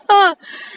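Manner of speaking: short high laugh; self-mocking little burst of laughter; real embarrassed chuckle. Real, not acted. Laughs at own delay.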